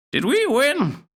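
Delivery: slow, high granny asking; a confused old gran, drawn out; high old grandmother voice. Acted. Grandmother asking Zack about the noise, slow high old-lady voice.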